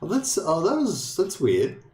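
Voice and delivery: high, slow, baffled "that's weird"; drawn-out real bewilderment; puzzled surprise. Real, not acted. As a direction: let it catch you off guard. Sudden puzzling change on the screen.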